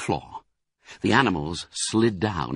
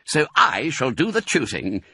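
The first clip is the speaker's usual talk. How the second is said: higher-pitched, firm command; giving orders; 7 semitones higher than usual. A narrator's reading. Fox holds back hungry animals, giving orders. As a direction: take charge of the party. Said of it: "ordered Mister Fox".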